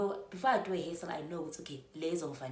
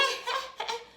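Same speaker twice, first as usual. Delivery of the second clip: high laugh. Retold or imitated. Mom laughing while answering.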